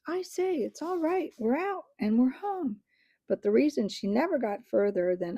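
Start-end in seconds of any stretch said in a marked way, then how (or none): 0.1-2.8 high excited call down a hole; shouting down. A narrator's reading. Eustace shouting down to friends below, high and excited. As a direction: call the good news down to the others. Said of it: "turned almost at once to shout down".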